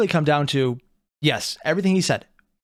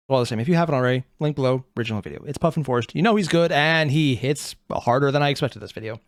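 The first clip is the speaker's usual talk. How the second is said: ordinary talk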